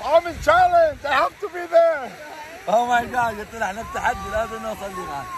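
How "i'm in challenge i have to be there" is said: calling out. Real, not acted. Shouting from freezing water.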